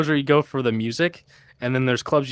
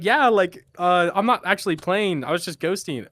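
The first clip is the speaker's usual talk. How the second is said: imitating Phil; 7 semitones higher than usual. Quoting Phil's reply.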